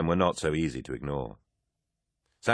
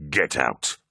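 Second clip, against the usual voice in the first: low, hard command; angry dismissal; 4 semitones lower than usual. A narrator's reading. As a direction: bark him out of the room. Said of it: "Horus yelled".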